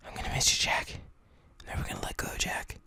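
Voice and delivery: very soft, breath-only farewell; hammed-up hushed parting; mock grieving whisper. Shown as a demonstration. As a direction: whisper it like a melodramatic goodbye. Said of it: "where I started talking like".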